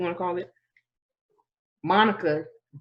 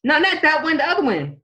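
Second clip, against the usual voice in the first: excited; 8 semitones higher, 4 dB louder than usual. Real, not acted. Excited correction in high pitch.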